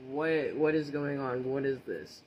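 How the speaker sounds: loud, drawn-out what, then baffled questioning; retold bewilderment. Retold bewildered reaction to the sight.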